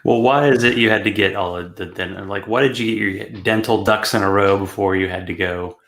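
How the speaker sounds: usual speaking voice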